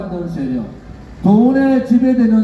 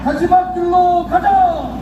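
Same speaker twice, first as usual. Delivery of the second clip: roaring rallying speech; 7 semitones higher than usual. Real, not acted. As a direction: bellow each line to rally troops. Closing rally chant to supporters.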